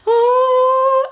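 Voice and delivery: long, high imitated "aww"; group sympathetic coo. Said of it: "I just heard everyone go".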